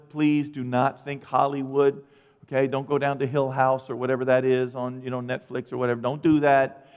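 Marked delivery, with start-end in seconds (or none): none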